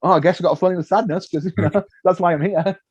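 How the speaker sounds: fast, wry shrug; mock resigned. Agreeing to fake sadness, fast and wry. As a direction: rush it with a laugh. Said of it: "And you're like".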